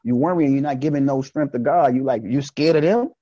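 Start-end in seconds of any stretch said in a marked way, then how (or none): none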